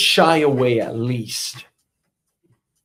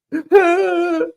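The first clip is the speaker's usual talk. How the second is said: burst of laughter; 13 semitones higher, 3 dB louder than usual. Real, not acted. Laughing at own bit.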